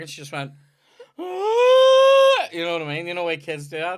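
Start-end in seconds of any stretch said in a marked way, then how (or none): 1.2-2.5 loud, high imitated scream; long mock shriek of a startled kid. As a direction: let out a sudden high yell, acting out delayed shock. Imitating sister noticing dart in leg.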